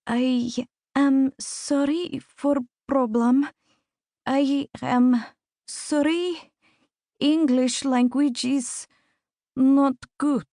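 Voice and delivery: put-on slow, broken English in a Russian accent; halting Russian accent. A narrator's reading. Embarrassed visitor apologises to the doctor, in halting Russian-accented English. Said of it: "A Russian accent".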